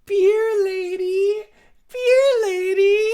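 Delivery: high, slow, loud sing-song call; hammed-up plea for a beer; whiny call to the beer lady. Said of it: "and I'm like".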